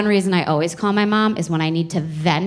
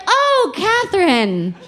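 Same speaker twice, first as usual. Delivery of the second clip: higher, slow dawning recognition; clueless dad; slower than usual. Retold or imitated. Dad slowly placing his daughter's best friend, lightly voiced. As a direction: pretend you finally remember her, lightly voiced. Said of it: "my dad's like".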